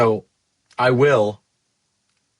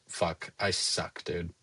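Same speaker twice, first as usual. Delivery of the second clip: low, very soft, slow self-blame; dejected self-talk; 8 semitones lower, 14 dB quieter, slower than usual. Retold or imitated. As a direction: act out sinking defeat under your breath. Acting out dejection at losing customer.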